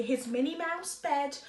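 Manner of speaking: usual speaking voice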